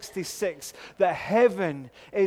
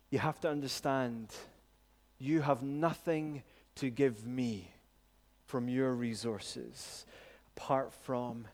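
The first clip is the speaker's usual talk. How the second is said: tender Jesus voice; 5 semitones lower, 4 dB quieter than usual. Retold or imitated. Jesus gently reassuring Peter about the foot washing, softly. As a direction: speak to him like a patient friend, low and gentle.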